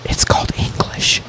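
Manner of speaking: a muttered jab, barely voiced; sarcastic whisper. Real, not acted. Whispering a snide jab at his friend over a word. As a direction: whisper it under your breath, dry and snide. Snarky aside to co-host.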